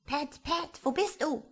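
high, irritated call; annoyed voice. A narrator's reading. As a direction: call impatiently for a missing servant. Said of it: "kam eine ärgerliche Stimme".

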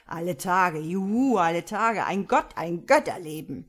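loud, tipsy exultation; drunken cheering. Acted. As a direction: whoop it up drunkenly.

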